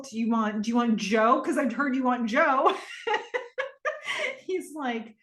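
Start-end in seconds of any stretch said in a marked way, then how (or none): 1.4-5.2 teasing line dissolving into laughter; laughing. Real, not acted. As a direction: tease him and crack up.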